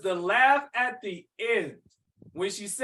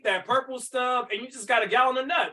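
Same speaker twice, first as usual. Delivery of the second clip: ordinary delivery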